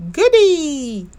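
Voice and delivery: high, loud happy little bear; a child's gleeful yelp; excited cheer. Acted. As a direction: bounce up with delight. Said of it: "said Bear".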